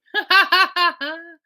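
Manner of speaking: sudden high burst of laughter; a laugh that rises up high; real laugh. Real, not acted. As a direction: break out laughing at the absurd ad.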